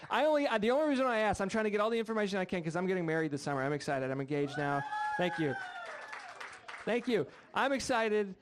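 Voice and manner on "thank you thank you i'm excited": a bit higher and brighter, fishing for applause; cheery announcement. Shown as a demonstration. Groom prompting the crowd to cheer, upbeat. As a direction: sell it so they clap. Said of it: "When I say it, people clap".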